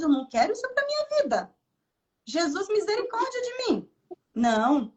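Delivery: high, dramatic cry for mercy; pleading exclamation. Real, not acted. Pastor recoiling from taking God's glory, pleading. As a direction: throw it up to heaven in mock horror.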